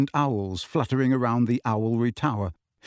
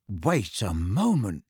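slowly; 4 semitones lower, slower than usual. A narrator's reading. Harry working something out, slowly. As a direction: spell it out as it dawns. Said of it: "said Harry slowly".

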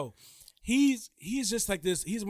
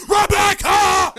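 snapping, very high full-force yell; Klaus; 14 dB louder, much slower than usual. Acted. Vampire snaps at his little sister, furious Klaus scream. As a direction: explode on the name, long and loud. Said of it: "He just be snapping".

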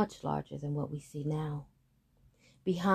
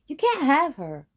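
high, loud defiant no; loud refusal; 9 semitones higher, 7 dB louder than usual. Acted. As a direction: shout the refusal. Boy refuses the heifer.

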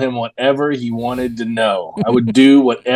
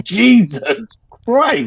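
high-pitched appalled exclamation; mock-shocked, pitched well up; retold shock; 11 semitones higher, much slower than usual. Retold shocked reaction to needle.

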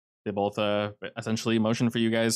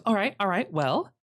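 higher voice, settling down after joking; wife character; 10 semitones higher than usual. Acted. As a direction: play her high, reining in the jokes. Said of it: "And goes".

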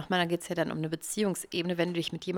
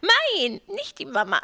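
put-on much higher whiny baby voice; Baby from Dinos; 4 dB louder than usual. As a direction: squeal it like a demanding baby. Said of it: "eins zu eins das Baby aus den Dinos".

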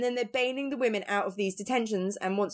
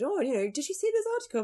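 husband voiced; 8 semitones higher, faster than usual. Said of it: "my husband said".

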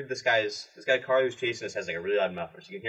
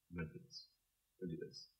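murmured much softer, a private pep talk; quiet self-talk; 7 semitones higher, 19 dB quieter, much faster than usual. Retold or imitated. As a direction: hush it down as if to yourself. Psyching himself up to race.

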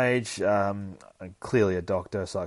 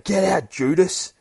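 higher and louder, slow, hurled accusation; furious shout; 11 semitones higher, 11 dB louder, slower than usual. Acted. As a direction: throw him out with a yell. Acted quarrel, throwing friend out.

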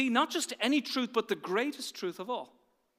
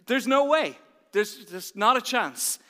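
louder, higher "no way" in mock panic; panicked refusal; 4 semitones higher, 8 dB louder, slower than usual. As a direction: blurt out the refusal like a nervous young man.

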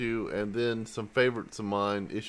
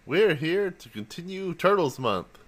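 usual speaking voice

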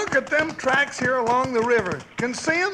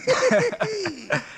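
higher, easy laugh; chuckle; 7 semitones higher than usual. Real, not acted. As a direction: laugh off the boast. Laughs after his boast.

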